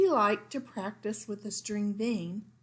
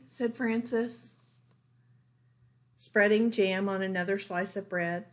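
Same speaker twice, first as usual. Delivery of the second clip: plain reading voice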